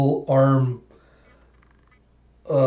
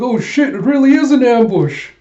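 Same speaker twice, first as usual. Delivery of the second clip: much higher, loud panicked yell; shocked shout; 10 semitones higher, 7 dB louder than usual. Acted. He spots the trucks, loud shocked yell. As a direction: blurt it out in sudden alarm.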